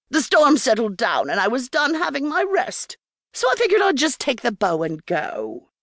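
put-on a wily old hag talking with her mouth full; Beatrice. Hint of a crone telling Quinn how she took the bow.

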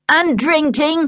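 teasing boast; winding her up. A narrator's reading. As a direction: add it to goad a sister. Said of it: "Luke tried to wind her up".

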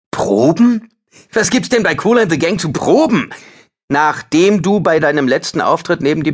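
scoffing outrage. Acted. Ben scoffs at Lars over skipped rehearsals, high and loud. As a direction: sneer that rehearsals are pointless.

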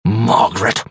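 name said as a chilling rebuke; cold warning. A narrator's reading. Doctor silencing his wife at table, cold and cutting. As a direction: stop her with one icy word. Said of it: "in a tone that set a cold knife".

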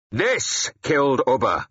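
high, slow, forceful declaration; declared. A narrator's reading. Warrior drawing his sword before the king, declaring. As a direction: declare it defiantly, word by word. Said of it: "I declared".

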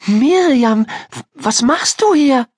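put-on high, stammering girl's voice of disbelief; stunned. A narrator's reading. Ida to the friend who turns up unexpectedly, stunned. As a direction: gasp it out high, stumbling on a word. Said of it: "fragte Ida fassungslos".

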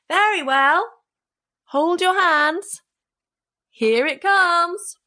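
high, stretched-out holler; loud calling. A narrator's reading. Tom calls to the thieves waiting outside, as loud as he can. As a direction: call each phrase slowly, high and held. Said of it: "called out as loud as he could".

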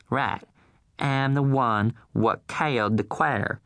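mock backwoods boy blurting it out; rural Southern kid. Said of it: "Suppose one of 'em says".

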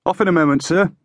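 high, cheery call upward; bright-spirited, high-pitched hail; cheerful call. A narrator's reading. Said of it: "he called up cheerfully".

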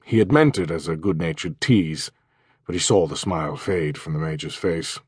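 plain reading voice

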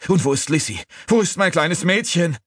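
high, panicked demand; horrified cry. A narrator's reading. Father asking servant after his daughter, high and panicked. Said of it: "mit einem Mal das Entsetzen packte".

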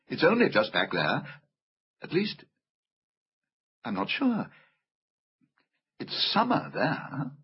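put-on soft, halting girl's voice; unsure little girl. A narrator's reading. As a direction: trail along uncertainly as young Lucy. Voices Lucy.

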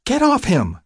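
high, forceful order to back away; urgent command. Acted. Defending the panicking patient from rough handling, urgent high command. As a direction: cut in sharply on a high pitch.

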